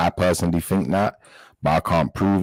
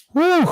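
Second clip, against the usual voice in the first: a sudden high, louder whew to let off steam; sharp high whew; 5 dB louder than usual. Real, not acted. Viewer reacting to upsetting video, sharp high whew.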